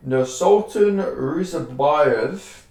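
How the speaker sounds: very slow, syllable by syllable on Nursultan Ruzabayev; careful pronunciation. Real, not acted. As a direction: spell out the unfamiliar name slowly and carefully. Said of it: "excuse me for this pronunciation".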